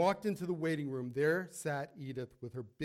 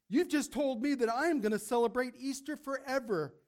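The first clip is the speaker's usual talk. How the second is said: higher, louder, mock-scolding old lady; joyful scolding; 5 semitones higher, 4 dB louder than usual. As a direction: chide him with bright joy. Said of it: "Edith said".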